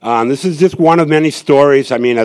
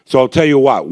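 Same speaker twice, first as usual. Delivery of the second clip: usual speaking voice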